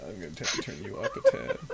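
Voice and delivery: fast, laughing through the words. Real, not acted. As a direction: chuckle while you speak. Laughs while adjusting levels.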